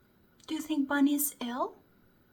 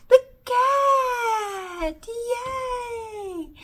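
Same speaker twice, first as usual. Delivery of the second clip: excited; 7 semitones higher, 6 dB louder, much slower than usual. Acted. Excited guess for kids.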